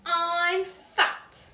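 lightly sighing "I'm fat" as the mum; Missus Large. A narrator's reading. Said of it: "said Missus Large".

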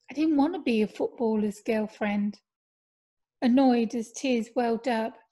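usual speaking voice

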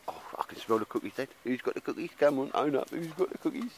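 playful whiny dog asking who has the cookies; pretend dog voice.

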